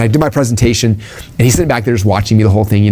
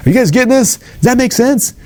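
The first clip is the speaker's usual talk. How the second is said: much higher, pumped-up speaker voice; hyped stage questions; 12 semitones higher, slower than usual. Shown as a demonstration. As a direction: fire up the crowd from stage. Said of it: "I recorded myself saying them, like".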